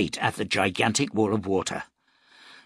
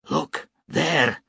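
a raised call, higher; shouted; 5 semitones higher than usual. A narrator's reading. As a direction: point and call it out. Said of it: "he shouted".

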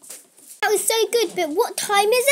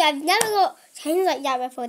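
ordinary talk

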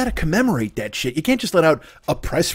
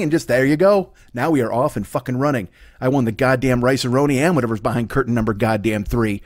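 ordinary talk, nothing marked

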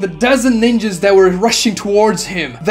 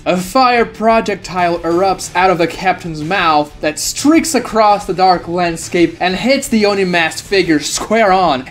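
ordinary delivery, nothing marked

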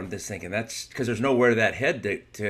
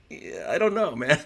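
much higher, hesitant and drawn back; reluctant hedge; 6 semitones higher than usual. Retold or imitated. Turning down Mark's fight offer, a lightly voiced reluctant hedge. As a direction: sound unsure and wanting out. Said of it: "And I'm like".

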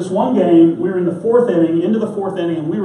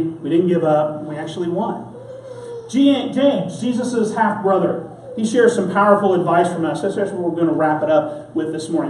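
ordinary talk

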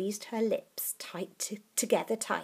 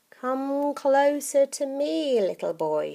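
put-on coaxing old witch; 8 semitones higher, 7 dB louder than usual. Grandma beckoning.

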